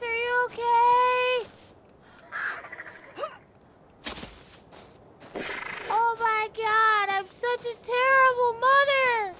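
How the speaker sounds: high, loud, stretched-out frantic cries; panicked distress. Real, not acted. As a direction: call out in real panic for a fallen pet. Panics over fallen pet.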